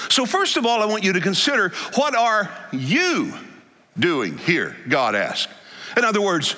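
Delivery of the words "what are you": ordinary delivery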